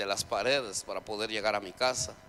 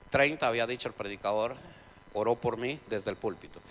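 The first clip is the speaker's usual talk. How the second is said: ordinary talk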